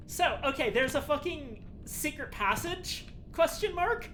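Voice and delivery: can't believe what you read; incredulous. Real, not acted. Disbelieving outrage.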